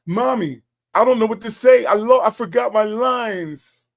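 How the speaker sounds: high, loud mock child voice; whiny five-year-old. Mocking Dre as a kid who forgot his Easter speech, whiny five-year-old. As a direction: imitate a little kid whining to Mommy. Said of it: "You sound like a five-year-old that forgot his lines".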